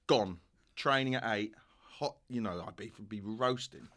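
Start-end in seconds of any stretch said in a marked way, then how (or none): none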